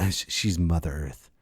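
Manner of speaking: ordinary talk, nothing marked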